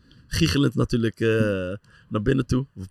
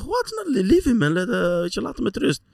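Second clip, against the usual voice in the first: retold disbelief; 13 semitones higher than usual. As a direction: burst out high in disbelief. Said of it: "Ik zeg".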